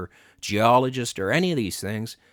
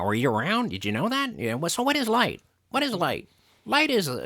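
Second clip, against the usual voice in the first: higher, preachy lecturing voice with questions thrown at the listener; mocking Hovind; 6 semitones higher than usual. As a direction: mimic a smug con-artist preacher grilling kids. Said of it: "he talks like this".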